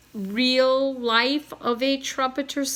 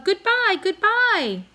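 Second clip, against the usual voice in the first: soaring goodbye; 11 semitones higher, 5 dB louder than usual. A narrator's reading. Cob's dramatic farewell.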